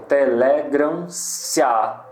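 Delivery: "telegram-se-á" slowly scanned, leaning on "le"; syllable by syllable. Shown as a demonstration. Teacher showing the joined syllable, slow scansion of "telegram-se-á". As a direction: draw out "telegram-se-á", joining "se" and "á". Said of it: "é uma sílaba tônica".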